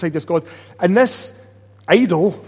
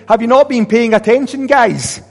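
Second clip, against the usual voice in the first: louder, higher exasperated scolding; 7 semitones higher, 7 dB louder than usual. Acted. Prophet scolding careless people, exasperated and loud. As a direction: scold them like a fed-up teacher. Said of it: "He's kinda saying".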